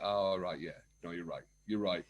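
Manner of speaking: low, soft retold climb-down; sheepish concession. After licking his wounds, agrees with wife, sheepishly. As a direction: give in quietly, voice dropped.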